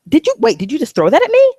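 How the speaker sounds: high, loud outraged disbelief; outraged shock. Pretend. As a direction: react like something just hit you. Imagined guy throws filth at him.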